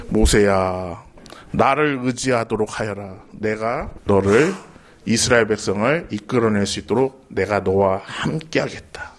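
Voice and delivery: voice of God. Acted. God promising Moses help, deep and solemn. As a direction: speak low and steady as God.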